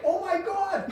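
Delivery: high, shrill mock panic; panicked crowd. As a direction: customers freaking out. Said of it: "Everybody in the store's like".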